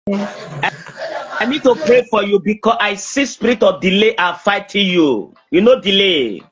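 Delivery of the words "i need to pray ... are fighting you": ordinary delivery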